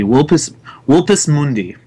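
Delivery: Vulpus said as wulpus. Shown as a demonstration. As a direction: Vulpus Mundi, V sounded as W. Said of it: "the V would be like a W".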